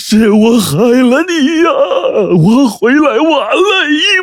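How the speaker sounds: high-pitched, drawn-out crying wail; loud wailing. A narrator's reading. Husband throws himself on his poisoned wife, wailing loudly. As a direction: bawl each phrase out in anguish. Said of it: "扑到王玉身上大哭".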